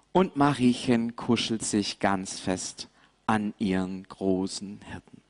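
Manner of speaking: ordinary delivery, nothing marked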